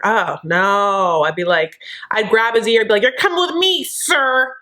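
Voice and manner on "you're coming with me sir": high-pitched pretend telling-off; mock scolding. Granddaughter dragging her grandfather away from a date, mock scolding. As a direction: haul him off with a high, playful order.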